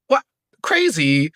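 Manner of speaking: ordinary delivery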